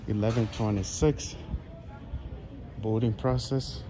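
ordinary talk, nothing marked

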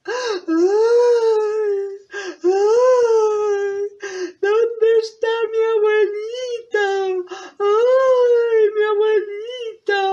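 high, hammed-up sobbing wail; tearful wailing. Girl missing her grandmother, high mock wailing. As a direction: wail long and high like a crying child. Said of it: "se quedó muy triste".

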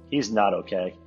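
ordinary talk

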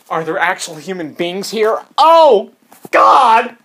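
building to a high, loud cry; exaggerated exclamation; alarmed outburst. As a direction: blow up in mock dismay. Alarm that people might be around.

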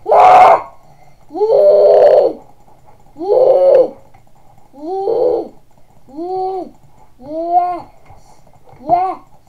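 loud high whooping yells; victory yelling. Real, not acted. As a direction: whoop over the win. Celebrating a clutch win.

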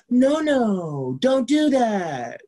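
high, loud mock scolding, drawn out at the end; scolding parent figure. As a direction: mimic a finger-wagging parent from on high. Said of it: "intangible parent figure who shakes a finger at us".

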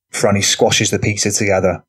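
plain reading voice

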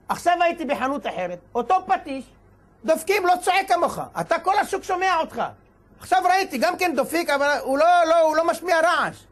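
high-pitched, insistent complaint; indignant reproach. Acted. Passer-by scolding the noisy tinsmith's iron, indignant reproach. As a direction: take someone to task, pitched well up.

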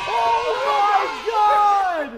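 slow, drawn-out thrilled yell; ecstatic shout. Real, not acted. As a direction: stretch it out in disbelief. Walk-off win celebration.

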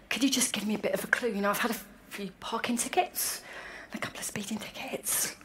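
whispered, half-embarrassed confession; a small ashamed aside; sheepish whisper. Retold or imitated. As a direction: own up in a guilty whisper.

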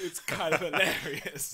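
laughing through the words, loud; loud, drawn-out amused delivery; real laughter. Real, not acted. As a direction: crack up while saying it slowly. Cracks up over comic plot.

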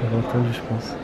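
frightened whisper while hiding; scared whisper. Real, not acted. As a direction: whisper so the seeker can't hear. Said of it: "J'ai vraiment trop peur".

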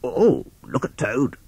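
gentle slow animal voice; Rat voice. Acted. Rat character voice.

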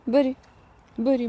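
normal talking voice

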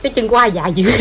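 ordinary talk

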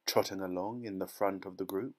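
plain reading voice

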